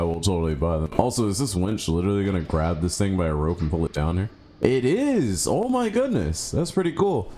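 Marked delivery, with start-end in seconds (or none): 4.6-7.3 high-pitched amazed exclamation; delighted amazement. Real, not acted. Player seeing the winch really work, amazed. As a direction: marvel at it, voice soaring.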